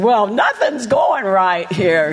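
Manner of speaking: slow, hammed-up complaint; exaggerated woe-is-me moan; mock upset. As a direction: imitate someone wallowing in misery, drawn out. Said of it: "And that's how I was".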